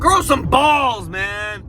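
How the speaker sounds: slow, high yelled order; shout. Real, not acted. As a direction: yell it at the screen. Yelling at the screen.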